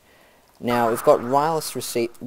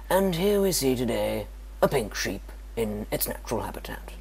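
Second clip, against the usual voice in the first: hushed documentary narrator whisper; nature documentary. Pretend. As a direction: whisper like a wildlife filmmaker. Nature documentary parody about sheep.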